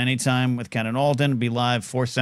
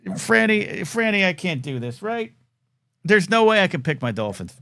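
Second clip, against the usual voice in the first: ordinary talk, nothing marked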